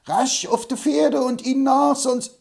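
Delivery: rally everyone to the horses; excited old man yelling to ride after them; urgent shout. Acted. Hobble Frank urges pursuit.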